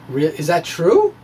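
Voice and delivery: ordinary talk, nothing marked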